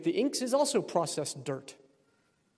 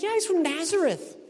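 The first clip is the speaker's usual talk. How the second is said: high, bright and keen; eager Philip; 13 semitones higher, 4 dB louder than usual. Retold or imitated. Philip answers Nathanael's doubt: high, eager reply. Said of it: "He's like".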